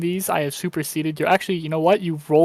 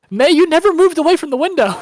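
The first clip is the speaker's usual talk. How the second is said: excited; 11 semitones higher, 7 dB louder than usual. Real, not acted. GM laughing reveal.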